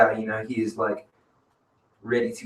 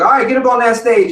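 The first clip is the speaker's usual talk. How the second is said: higher, louder barked order; bossy order; 11 semitones higher, 8 dB louder than usual. Retold or imitated. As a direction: order him onto the stage, loud and high. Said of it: "I was like".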